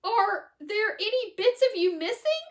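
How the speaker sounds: high, loud anxious plea; a frightened mother checking on her son; worried cry. A narrator's reading. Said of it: "cried Missus T V".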